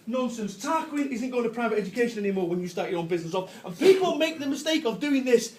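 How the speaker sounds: ordinary talk, nothing marked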